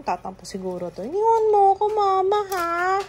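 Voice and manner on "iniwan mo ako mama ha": mock hurt whine. Mama plays hurt to the baby, high sulky whine. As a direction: play wounded to get the baby's attention.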